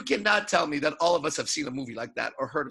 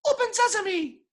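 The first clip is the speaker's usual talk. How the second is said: much higher, thin squeak; squeaky weak voice. Retold or imitated. A weak guy at the cave door, squeaky. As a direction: squeak it out timidly. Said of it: "someone goes up and goes".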